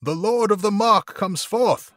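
loud, high, slow proclamation; stately shouted announcement, drawn out; proclaiming cry. A narrator's reading. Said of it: "he cried".

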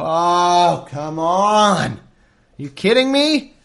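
loud groaning protest, climbing high; exasperated disbelief. Real, not acted. Reader learns Pharaoh won't let the people go, loudly exasperated. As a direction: protest loudly, pitch leaping on the last question.

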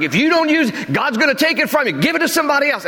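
high-pitched, mock-insistent warning; mock shaming preacher. Preacher shaming people to use their gifts, mock high-pitched threat. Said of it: "I've heard that over and over again".

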